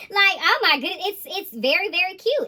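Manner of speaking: high, loud squeal into giddy words; delighted squeal. Real, not acted. Squealing over romance energy.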